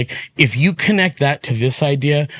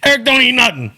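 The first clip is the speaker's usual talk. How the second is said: much higher, louder hyped rant; Joey's pumped-up voice; 9 semitones higher, 3 dB louder than usual. Retold or imitated. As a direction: preach at a friend like a fired-up coach. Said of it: "And he would say like that".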